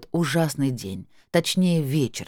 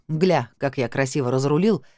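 plain reading voice

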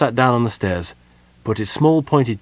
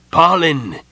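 loud, raised-pitch call; urgent shout; 7 semitones higher, 6 dB louder than usual. Acted. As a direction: shout the name in alarm. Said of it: "he shouted".

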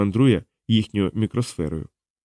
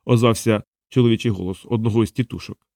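usual speaking voice

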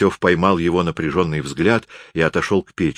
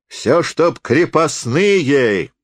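high, slow, drawn-out bitter outcry; strained soldier's cry; 8 semitones higher, 3 dB louder, much slower than usual. A narrator's reading. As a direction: cry it out with strain, stretching the words. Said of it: "крикнул солдат с надрыву". (крик с надрывом, высоко и протяжно)

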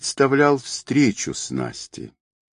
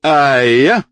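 loud, high fighting cry; drawn-out martial yell on the leap; raised battle cry; attack yell; 5 semitones higher, 6 dB louder than usual. A narrator's reading. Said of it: "с криком".

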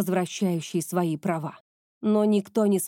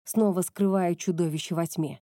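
usual speaking voice

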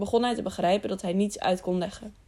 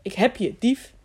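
short, mildly raised cry; accusing cry; 3 semitones higher, 4 dB louder than usual. A narrator's reading. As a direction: call out as if catching a thief. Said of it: "pakte Tiuri ruw bij zijn arm en riep".

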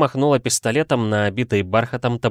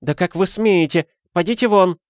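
high, raised-voice lady's outrage; indignant outburst; 10 semitones higher, 4 dB louder than usual. A narrator's reading. Madame throwing out the policeman, indignant. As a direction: order him out, pitch raised.

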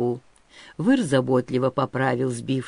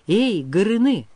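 higher, raised-voice hail; calling out; 4 semitones higher, 4 dB louder than usual. A narrator's reading. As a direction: call the boy over. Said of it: "позвал Выр".

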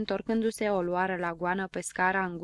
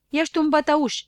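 higher, louder mocking taunt; teasing shout; 8 semitones higher, 8 dB louder than usual. A narrator's reading. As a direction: throw the taunt again, sharper. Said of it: "repetă ea". (strigăt batjocoritor, mai tare)

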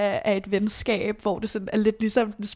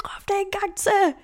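cheering on; 10 semitones higher than usual. Retold or imitated. Cheering friend to shout again.

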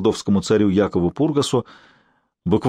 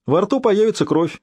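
light, higher-pitched cheerful reply; 9 semitones higher than usual. A narrator's reading. Friend answers a worried patient about the danger sign, cheerfully. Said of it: "весело ответил приятель".

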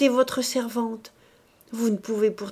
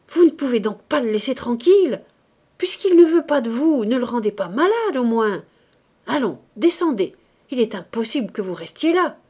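high, much louder, fast angry scolding; furious scolding; 4 semitones higher, 8 dB louder than usual. Acted. The mother furiously scolding Marthe to get out. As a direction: tear into her and drive her out. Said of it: "la foudroyant du regard".

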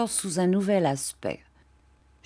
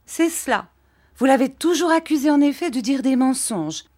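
put-on higher, reproachful young wife; bitter Dora; 7 semitones higher, 3 dB louder than usual. Dora answering her husband bitterly, higher pitched. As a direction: turn his words back on him.

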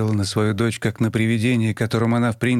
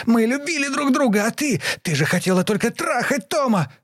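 very high furious yell; shrill scream; 13 semitones higher than usual. A narrator's reading. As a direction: scream the betrayal at her. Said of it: "Лиза прервала ... и закричала".

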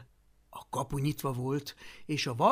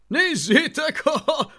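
loud, high jeering laugh; mocking laughter; 12 semitones higher, 11 dB louder than usual. A narrator's reading. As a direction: crowd mocking the warrior. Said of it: "mondogatták egymás hátát veregetve".

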